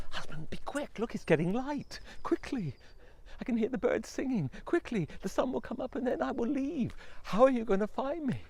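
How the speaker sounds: loud, high urgent pleading; urgent wife. Acted. Wife urging her husband to hurry, loud. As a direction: hurry him before dawn.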